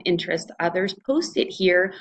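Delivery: ordinary talk, nothing marked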